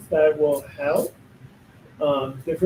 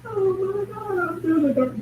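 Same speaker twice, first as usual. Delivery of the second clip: much higher, mock-horrified squeal; hammed-up panic over the dark; mock dramatic gasp. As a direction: parody someone spooked by their own darkness. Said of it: "It's like".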